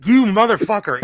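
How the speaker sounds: high, loud mock fury; outraged yell. Real, not acted. Reacting to a friend's worst-song pick, loud outraged yell. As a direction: shout it in betrayed disbelief. Friend picks his favourite artist.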